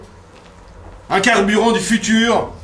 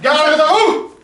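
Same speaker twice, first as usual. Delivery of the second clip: barked command; 7 semitones higher, 4 dB louder, much slower than usual. Acted. Drill sergeant addressing recruits.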